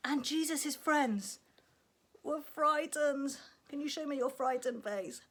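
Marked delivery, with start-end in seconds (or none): none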